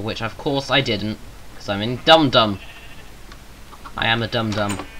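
ordinary talk, nothing marked

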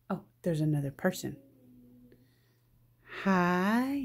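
normal talking voice

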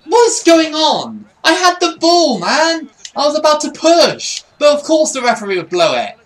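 high, loud exasperated yelling; outraged protest. Real, not acted. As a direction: shout your complaint at the referee. Protesting the referee's whistle.

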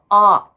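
long open あー, throat opened wide; open-throat vowel. Shown as a demonstration. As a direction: open the throat tall for a big あー. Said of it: "大きめに喉を開く感じの". (喉を大きめに開いた「あー」)

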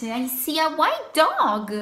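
usual speaking voice

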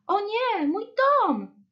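very high cry of alarm; loud high storybook wail; screaming beaver. A narrator's reading. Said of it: "Wrzasnął bóbr".

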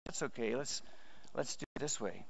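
soft, low reassurance; compassionate. Acted. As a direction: drop to a gentle, understanding murmur. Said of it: "they come with compassion".

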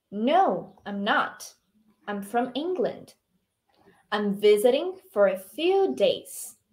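slow, measured language-teacher model; model dialogue reading. Shown as a demonstration. Teacher reading Emma's answer for students to repeat, slow model reading. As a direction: give each phrase slowly, with clear intonation. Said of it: "tenta imitar a minha entonação".

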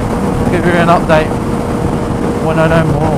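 loud over wind noise; shouting over wind. Real, not acted. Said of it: "don't know if you can hear me over the wind noise".

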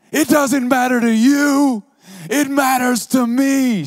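high, loud, anguished cry; mock scream. Woman screaming at Alex, high anguished scream. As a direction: scream it back at him. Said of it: "she screamed".

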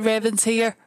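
ordinary talk

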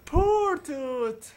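high, drawn-out pitying wail. Real, not acted. Player lamenting Toot's fate, high drawn-out pitying wail. Pities character stuck as mummy.

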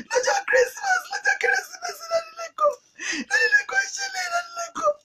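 very high falsetto keening; high, tearful lament; wailing grief. Acted. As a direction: cry out brokenly in acted mourning. Acted mourner calls on Dádì Lẹ́kọ̀ọ́.

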